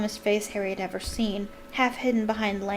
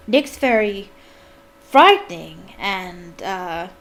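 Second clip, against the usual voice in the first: halting improvisation; 8 dB louder, slower than usual. A narrator's reading. Prompted praise, groping for words.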